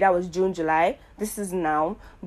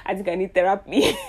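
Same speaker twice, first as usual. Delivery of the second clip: said through a real laugh, a bit louder; 5 dB louder than usual. Real, not acted. Joking to listeners that she needs therapy, laughing. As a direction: laugh the line out at yourself.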